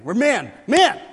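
loud high shout of MEN; mock macho shout. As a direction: bellow it like a proud tough guy. Preacher mocking macho pride.

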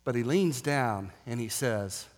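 ordinary talk, nothing marked

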